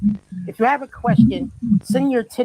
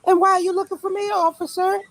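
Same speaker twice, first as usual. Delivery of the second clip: higher, dramatic innocent woman; high put-on voice; 8 semitones higher than usual. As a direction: play the startled suspect. Acting arrest skit.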